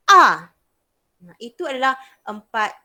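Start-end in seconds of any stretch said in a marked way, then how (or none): 0.1-0.5 loud short A falling sharply; abrupt scolding A; fourth tone, angry-sounding. Shown as a demonstration.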